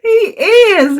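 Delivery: high, giggling words through real laughter; can't stop laughing as you say it; laughing while talking. Real, not acted. Laughing at the skinny doctor joke.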